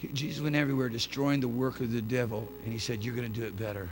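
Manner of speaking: low, soft, measured delivery; quiet restatement. Real, not acted. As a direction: say it again quietly, letting it sink in. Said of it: "I'm gonna say it again".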